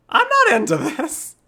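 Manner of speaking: very high, drawn-out loud squeal; squealed mock protest. Streamer refusing a game character's advance, squealed mock protest. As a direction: escalate into a slow, panicky squeak. Repeats protest, higher and slower.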